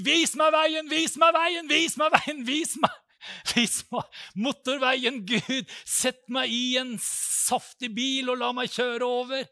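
loud, high desperate crying out; begging loudly for a highway; shouted plea to God. Retold or imitated. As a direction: cry out to God at the top of your voice. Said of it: "Du bare roper til Gud".

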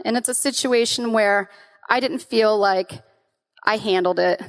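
ordinary delivery

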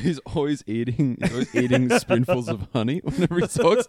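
giggling through the joke; laughing. Real, not acted. Joking while laughing.

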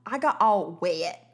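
put-on slow Southern drawl as a kid; drawn-out country twang on "wet"; recalled childhood Southern accent, stretched out. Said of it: "like, some country version of wet".

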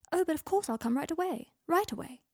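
high, sing-song mockery of a flippant reply; mocking sarcasm; sarcastic mock. A narrator's reading. Mocking Draco's sarcastic letter.

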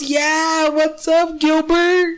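high, lightly voiced drunk guy; mock drunk greeting. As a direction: play the drunk host, cheerful and high. Drunk wedding host greets cousin.

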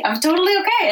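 high, breezy forced calm; mock serene; sing-song calm. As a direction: pretend everything's fine. Mock calm self-talk.

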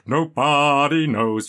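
mock the singer's funny voice slowly. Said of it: "in a funny voice".

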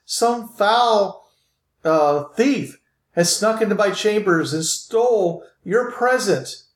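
high-pitched, theatrical female voice; lamenting lady. Acted. Lady confesses the present was stolen, high lamenting voice. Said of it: "you hear this female voice".

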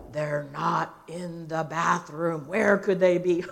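low, drawn-out mock searching; deep, grumbly voice. Dad pretends he cannot find his hiding children, deep grumbly voice. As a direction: play the hunting dad, slow and deep. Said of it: "deep, grumbly voice".

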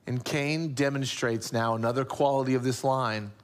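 ordinary delivery

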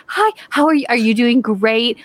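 high syrupy greeting, easing down; mocking sugary fake friendliness; mock baby talk. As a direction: coo as if to babies. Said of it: "talk to them like they're babies".

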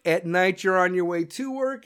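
usual speaking voice

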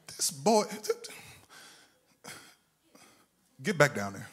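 a hint of an exasperated God, hushed; mock God muttering at a stubborn prophet; whispered God. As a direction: hush it like a weary God scolding. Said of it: "y'all don't think God talk like that".

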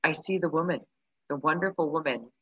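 ordinary talk

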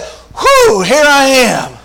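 letting out a long-held breath; Jesus relieved. Retold or imitated. Jesus unveiling his glory, high and relieved. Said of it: "and he said".

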